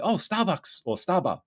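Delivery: high-pitched imitation of Japanese locals; sudden recognition, put-on Japanese accent; mock Japanese accent. Japanese locals finally understanding the tourist, imitated Japanese accent. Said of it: "they're like".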